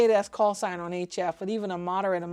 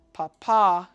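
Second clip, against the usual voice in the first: "papa" as pa-PAH, the end much louder; stress on second syllable; 8 dB louder than usual. Shown as a demonstration. Teaching a favourite phonetic word, "papa" stressed pa-PAH. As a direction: punch the last syllable of "papa". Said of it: "has emphasis on the second syllable".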